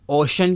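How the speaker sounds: اوشن with SH for the C; SH reading. Shown as a demonstration. Teacher asks why it is read so, SH sound. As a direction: say اوشن as OH-shun. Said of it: "وہ ش کی آواز".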